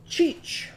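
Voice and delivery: high breathless screech on Cheech; bat screech. Pretend. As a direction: squeak Cheech like a tiny bat. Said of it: "what's Chauncey's bat gonna say?".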